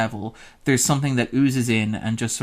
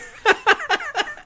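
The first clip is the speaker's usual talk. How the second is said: high laugh. Real, not acted. Laughing at the joke.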